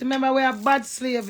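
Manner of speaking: ordinary delivery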